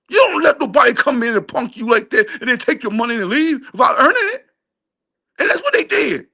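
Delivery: high, heated shouts building in frustration; irritated rant. Real, not acted. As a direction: let your anger at the coach boil over. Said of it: "I'm getting irritated now".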